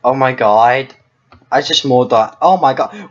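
ordinary talk

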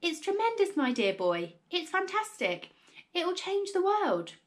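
high, gushing proclamation; excited cry. A narrator's reading. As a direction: rave about a wonderful scheme. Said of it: "cried Mister Cranky".

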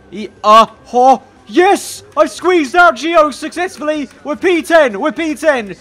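high, loud celebration; triumphant. Real, not acted. Gamer after squeezing past a rival, triumphant. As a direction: crow with real delight at a successful move. Celebrates reaching tenth place.

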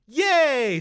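put-on high, gleeful kid cheer; excited ten-year-old self. Ten-year-old hearing about a snow trip, excited cheer. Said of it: "said ten-year-old me".

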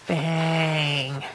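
ordinary delivery

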